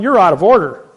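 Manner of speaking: loud, sharp objection in court; retold shout. The speaker shouting at the judge in court, loud objection. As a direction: shout the judge down mid-trial. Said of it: "I'm going".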